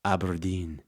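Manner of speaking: put-on low, soft Scottish accent. Host naming the listener's town, mock Scottish accent. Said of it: "Is that a, a Scottish accent?".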